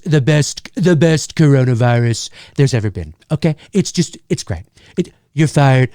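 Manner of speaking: lightly done Trump caricature, boastful and punchy; a quick cartoon Trump brag. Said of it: "caricatures like I do with Trump".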